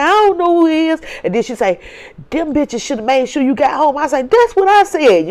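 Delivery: high, loud, lively sister chat; animated retelling. Retold or imitated. Telling big sister what happened, high and loud. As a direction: tell it high and worked up.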